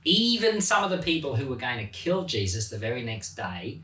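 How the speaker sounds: normal talking voice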